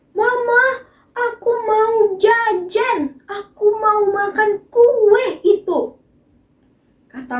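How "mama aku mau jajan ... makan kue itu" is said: put-on high, whiny little child voice; child asking mama. Child begging mama for cake, high and whiny. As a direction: plead like a kid wanting a snack. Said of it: "contohnya lagi".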